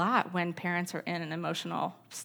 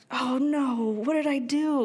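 dismayed oh no; 7 semitones higher, 5 dB louder than usual. Retold or imitated. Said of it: "And I'm just like".

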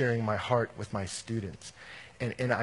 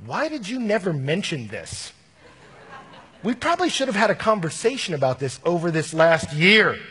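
higher, louder, half-laughing why-didn't-you-tell-me; a laughing mock complaint, pitched up and pushed louder; exasperated retort; 6 semitones higher, 8 dB louder than usual. Convert retold confronting missionaries.

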